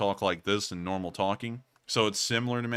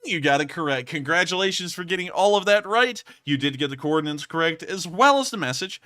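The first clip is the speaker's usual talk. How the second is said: excited; 7 semitones higher, 6 dB louder than usual. Real, not acted. Congratulating the Morse code decoders.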